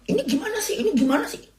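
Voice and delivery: high-pitched, soft nagging whine; fussy boss. Retold or imitated. Fussy boss fretting over the wet floor, high whiny nag. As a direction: whine it like a picky manager fretting.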